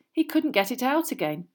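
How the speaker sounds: ordinary delivery, nothing marked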